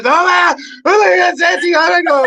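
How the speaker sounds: high, loud, breathless squeals of excitement; ecstatic agent. Retold or imitated. Agent calling late at night, squealing with excitement. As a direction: gush like she can't contain herself. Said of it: "she sounded like freaking, like, having an orgasm".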